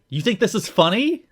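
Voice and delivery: angry confrontation. Acted. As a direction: confront him, mildly hammed-up anger. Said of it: "very angry, confronts Shelley".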